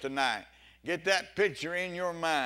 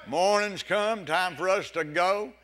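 loud, raised morning call; 5 semitones higher, 8 dB louder than usual. Acted. Shepherd calling the flock that morning's come, calling out. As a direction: preach it as a rousing summons, high and loud.

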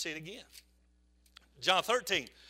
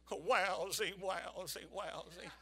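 a hint of a glum cartoon moan, much softer; Schlepp Rock gloom; 7 semitones lower, 16 dB quieter, much slower than usual. [name] as gloomy Schlepp Rock, lightly voiced. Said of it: "do you guys remember the Flintstones".

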